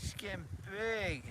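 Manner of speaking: high, slow, BIG drawn out; fond pet talk. Real, not acted. Owner showing off the five-month puppy, high fond voice. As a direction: gush over the puppy, stretched and high.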